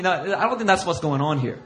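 normal talking voice

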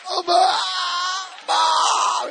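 hammed-up crying shout; imitating a sobbing outburst; high, loud mock wail. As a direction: cry out for mom, high and loud. Breaking down seeing mom's face.

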